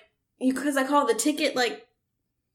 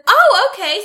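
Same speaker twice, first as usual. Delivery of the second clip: higher, louder mock oh-okay; mock surprise; 8 semitones higher, 7 dB louder than usual. Retelling her reply to the clerk, mock surprised. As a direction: play it dim and surprised. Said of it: "I was like".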